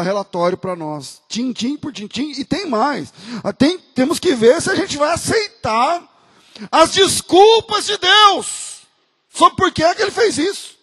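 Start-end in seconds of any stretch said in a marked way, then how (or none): 3.4-10.8 loud, higher, slow sarcastic stress; a pastor loudly mocking excuses demanded of God; ironic preaching. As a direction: lean hard into the irony.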